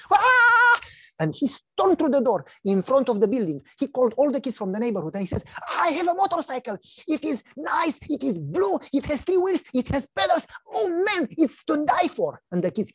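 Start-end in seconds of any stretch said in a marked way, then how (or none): 0.1-0.8 a very high, loud shriek of joy; joyful scream. Retold or imitated. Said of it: "started to scream like crazy". 5.7-12.4 put-on much higher, excited child bragging; boasting little boy. Son boasts to neighborhood kids.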